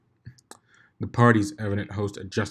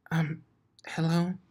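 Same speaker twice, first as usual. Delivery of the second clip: higher, timid little hello; shy quiet voice; 8 semitones higher, slower than usual. Acted. Fluttershy gently waking Blitz, shy and quiet. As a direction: barely dare to speak. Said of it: "the quietest and shyest voice".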